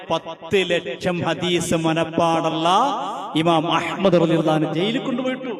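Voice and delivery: thundering sermon voice; fiery preaching. Acted. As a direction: build to a roaring pulpit climax. Preaches at full force.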